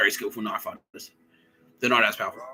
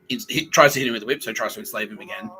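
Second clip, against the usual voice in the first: ordinary delivery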